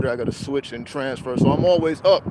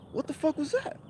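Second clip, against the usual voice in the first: very high, soft, rising bewilderment; mock-stunned high-pitched question; high bewildered; 12 semitones higher, 9 dB quieter than usual. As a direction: ask it, thoroughly spooked. Baffled after driver leaves.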